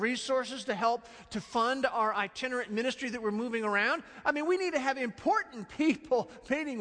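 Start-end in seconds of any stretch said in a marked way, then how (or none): 4.2-6.8 higher, self-important aside; mock-snobby disciples. Disciples dismissing children, mock snobbish. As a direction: sniff at the children as beneath them.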